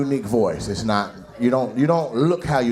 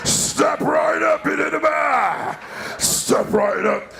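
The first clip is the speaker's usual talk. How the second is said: slow, high strained barker call; hoarse barker; 13 semitones higher, slower than usual. Pretend. Comic's barker call straining his throat. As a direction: stretch the call as the voice cracks.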